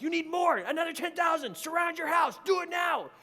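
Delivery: high, loud, forceful orders; acted-out battle commander; urgent commanding voice. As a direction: shout commands like reinforcements can't wait. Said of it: "And this voice is like".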